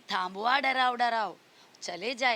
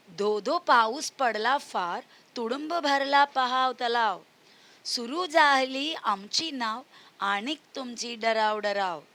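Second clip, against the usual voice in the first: put-on sing-song, louder recital of a children's poem; poem recitation; 4 dB louder than usual. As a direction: recite the verse loudly for kids. (बच्चों को कविता सुनाते हुए, ज़ोर से गाकर पढ़ना)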